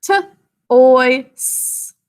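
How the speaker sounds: "t", "oi", "s" as separate sounds, the "s" just a hiss of breath; sounding out. Shown as a demonstration. Teacher splitting the second syllable into "t" "oi" "s". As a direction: give each of "t" "oi" "s" on its own. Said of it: "say it with me".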